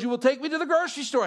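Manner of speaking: quick order in a put-on Russian accent; imitating a bossy Russian accent. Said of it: "thick, heavy Russian accent".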